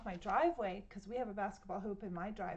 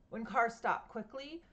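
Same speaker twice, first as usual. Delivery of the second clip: ordinary delivery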